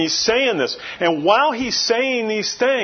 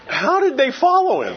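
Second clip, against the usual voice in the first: puzzled wondering; 8 semitones higher than usual. Retold or imitated. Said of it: "And I'm like".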